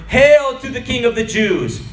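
high, loud mocking chant-like cry; soldiers' repeated hail. A narrator's reading. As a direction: hail him loudly across the yard. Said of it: "saying over and over".

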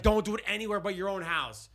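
high, loud scolding rule; mock official warning. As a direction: lay down the rule loudly. Voicing lawmakers' restriction.

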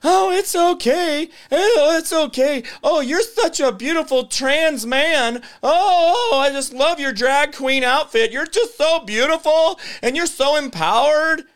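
high, slow, sugary mock-gushing voice; mocking gushing women. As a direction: ham up cooing praise. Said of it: "you guys over here celebrating".

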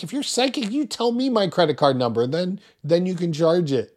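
high, sarcastic dare to the psychic; poking fun at the psychic hotline caller, high mock challenge. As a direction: needle them with a high mocking voice. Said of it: "And I'm like".

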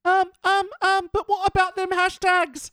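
squeaky high hesitant parody; hammed-up high little voice; mock younger self. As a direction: ask it way up high, stumbling in.

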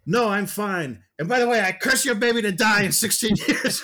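high, loud mock villain retort; hammed-up retold villain, laughing at the end; Maleficent. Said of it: "Maleficent's like".

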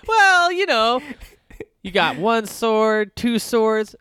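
mock professor voice. Mock lecture on swords.

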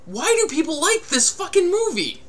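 mock incredulous rant; exasperated rant. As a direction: throw up your hands in disbelief. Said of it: "I'm just like".

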